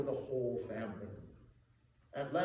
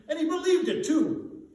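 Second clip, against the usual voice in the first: much higher and louder, delighted; laughing cry; 12 semitones higher, 8 dB louder than usual. A narrator's reading. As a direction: crow it out in glee. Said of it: "cried Scrooge's nephew".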